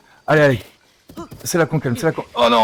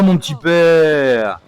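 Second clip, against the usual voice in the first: high, loud, slow pleading; frantic pleading; 5 semitones higher, 7 dB louder, slower than usual. Acted. Rider begging the bucking horse, loud and drawn out. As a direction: beg the horse, loud and stretched.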